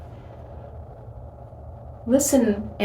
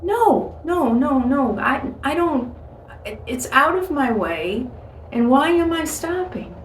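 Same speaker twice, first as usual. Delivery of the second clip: higher, stammering objection; flustered refusal; 4 semitones higher than usual. Acted. Driver arguing with God about a detour, flustered refusal. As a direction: balk and make excuses.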